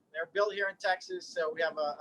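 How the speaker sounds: ordinary delivery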